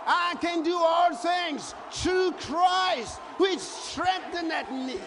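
loud, high, rousing sermon chant; preaching at full force. Acted. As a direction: declaim the verse like a revival preacher. Preacher shouting scripture to congregation.

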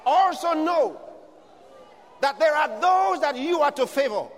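loud, high, shouted preaching; preaching at full force. Acted. Preacher shouting a promise of restoration to the congregation, at full force. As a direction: thunder the promise over the congregation.

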